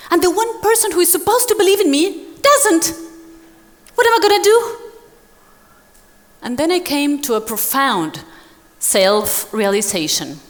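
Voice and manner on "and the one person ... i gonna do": high, fast, panicked; panicked despair. Retold or imitated. Author leaving the meeting, voicing high fast panic. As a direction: blurt it out in rising panic. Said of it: "I was like".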